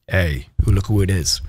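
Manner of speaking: deep, played-down "look who it is"; low-voiced redo of an excited hello; cool, low greeting. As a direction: drop it low and casual.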